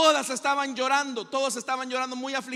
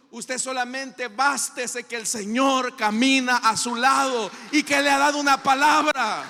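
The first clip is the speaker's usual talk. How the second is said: preaching at full force; 8 dB louder than usual. Acted. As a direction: build the congregation's faith with force. Preaching climax. (predicación enérgica y fervorosa)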